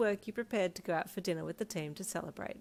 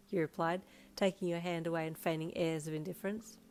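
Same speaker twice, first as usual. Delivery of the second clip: plain reading voice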